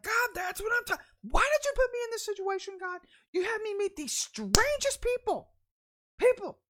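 high, loud complaint to God, slowing on strangest; shouting at God. Retold or imitated. Missy complaining to God in the deli, loud exasperated shout. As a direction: throw it up at heaven, fed up. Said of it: "And I'm like".

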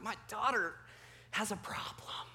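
hushed, voiceless aside; mock whisper. Dad confiding about his stone-faced daughter, whispered. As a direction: whisper it like a worried secret. Dad's joking inner thought.

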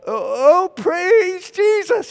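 high, drawn-out, awkward loud attempt. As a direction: try hard to praise at volume.

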